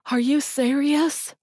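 high, loud, slow tearful disbelief; sobbing disbelief. Acted. Mother stunned by Stuart's offer, sobbing. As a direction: choke out the question through tears. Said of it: "The woman began to sob".

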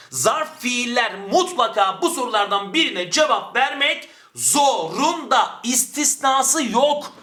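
slow, high-pitched emphatic teacher's rule; yükselen ses. Shown as a demonstration. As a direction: drive each word home slowly, pitch climbing. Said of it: "Ses burada yükselsin". (sesi yükselterek, ağır ağır ve vurgulu)

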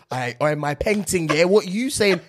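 imitating an American's British slang; bad British accent. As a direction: ham up a fake British accent. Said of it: "British accent is like".

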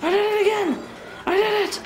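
high triumphant squeal; excited squeal. Real, not acted. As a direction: shriek with joy. Triumph after win.

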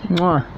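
soft smacking air kiss; kiss sound. Real, not acted. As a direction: blow a kiss to the camera. Blows a kiss.

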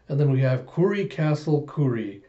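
usual speaking voice

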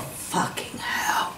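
breathless whispered curse under the breath; whispering to herself in shock. Retold or imitated. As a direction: hiss it out in a stunned whisper. Retold thought on seeing him.

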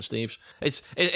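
normal talking voice

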